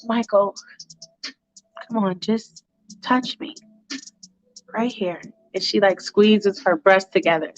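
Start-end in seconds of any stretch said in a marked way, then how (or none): 0.1-5.2 softly coaxing, lightly voiced temptress; seductive woman. Woman coaxing Michael to touch her, lightly seductive. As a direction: coax him quietly, with pauses. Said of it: "And she's like".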